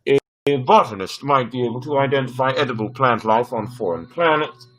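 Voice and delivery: even, machine-like computer; ship computer voice. Acted. Ship computer advising the captain on specialists, machine-like. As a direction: read it like a dutiful onboard AI.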